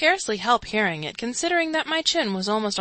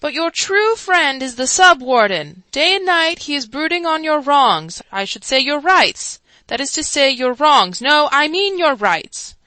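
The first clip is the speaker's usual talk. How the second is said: louder, higher fumbling speech; louder, higher muddled oration; flustered orator; 6 semitones higher, 9 dB louder than usual. A narrator's reading. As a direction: tangle wrongs and rights in earnest.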